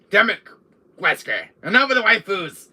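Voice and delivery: loud, high-pitched telling-off; mock exasperated scolding. Scolding Wesker over too many waifus, mock exasperation. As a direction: scold him in hammed-up exasperation.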